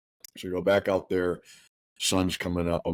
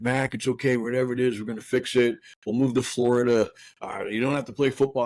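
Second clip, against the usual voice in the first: raised, urgent plea without a full shout; retold screaming plea; 6 semitones higher than usual. Father calling to his missing son in the woods, pleading, raised pitch. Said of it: "I'm, I'm screaming".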